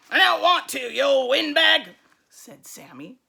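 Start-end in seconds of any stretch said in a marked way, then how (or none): 0.1-1.9 much louder, rude snarl; nasty monster boy. Acted. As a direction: growl it back at the teacher. Sammy defies the teacher.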